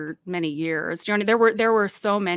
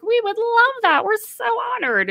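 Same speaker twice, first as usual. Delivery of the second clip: gushing parents; 13 semitones higher, 4 dB louder than usual. Retold or imitated. Parents accepting headquarters' invitation for their daughter, gushing. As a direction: imitate parents fawning over an honor.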